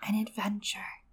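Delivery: softly repeated, dreamy and whispered; wistful whisper. Acted. Bran repeating his brother's promise in the dark, wistful whisper. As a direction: echo the word as if wishing. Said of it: "Bran repeated wistfully".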